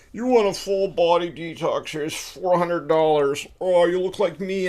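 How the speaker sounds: loud, high-pitched huckster pitch; mock salesman. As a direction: parody a pushy product seller. Mocks detox product sales pitch.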